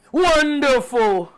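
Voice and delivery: high, drawn-out theatrical cry; slow, high-pitched showman's exclamation; Broken Matt Hardy. Acted. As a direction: stretch it out like a grand hammed-up entrance. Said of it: "It was broken Matt Hardy".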